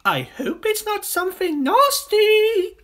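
very high, loud bird; singsong bird voice. Acted. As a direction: sing it out high and anxious to know. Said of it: "sang the roly-poly bird".

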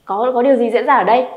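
ordinary talk

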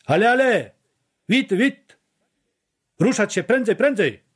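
high, urgent calls; hurrying shouts. A narrator's reading. Someone driving prisoners out of the block, hurrying shouts.

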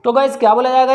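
ordinary delivery

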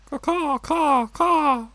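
high cawing crow voice; crow caws. Pretend. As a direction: caw like a crow to stay unnoticed. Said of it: "I'm a bird".

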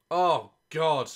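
normal talking voice

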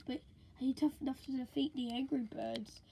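sly taunting whisper. Acted. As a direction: breathe it out as a sneaky challenge.

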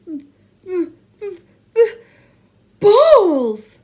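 stutter, then burst out high and loud; reluctant stammer. A narrator's reading. Teacher forcing out the dreaded B word, spluttering. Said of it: "could barely bring himself to say the B word".

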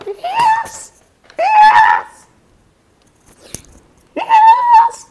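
very high squealed cheers; ecstatic shrieking. Real, not acted. Player celebrating a win, ecstatic shrieks. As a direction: squeal each cheer in triumph.

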